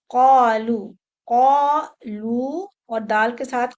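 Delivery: loud, slow क़ालू with the दाल bounced; qalqala demo. Shown as a demonstration. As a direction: stress the दाल echo in क़ालू. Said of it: "कलकला के साथ आएगा".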